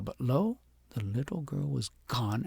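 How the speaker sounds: usual speaking voice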